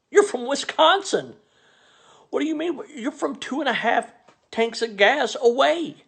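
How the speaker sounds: mock him for acting so far away; exasperated rant. Ranting at the Milwaukee comic, high exasperated disbelief.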